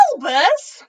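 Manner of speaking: loud, indignant cry of his name; soaked professor shouting at the headmaster; outraged shout. A narrator's reading. Drenched McGonagall shouts.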